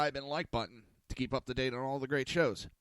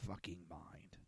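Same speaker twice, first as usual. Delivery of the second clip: whispered curse; 4 semitones lower, 13 dB quieter than usual. Real, not acted. Aside on CNN.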